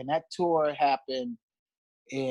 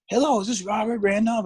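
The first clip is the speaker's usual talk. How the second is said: higher-pitched imitated English accent; 8 semitones higher than usual. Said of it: "It was like an English accent".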